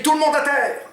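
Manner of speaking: high, loud hold-up yell; mock robber shout. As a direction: bark the order at the room. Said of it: "et tu cries".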